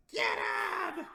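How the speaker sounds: loud, drawn-out goblin yell; hammered-out battle yell from a dying goblin; dying goblin's shout. Acted. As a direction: shout a last order to the other goblins. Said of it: "he shouts".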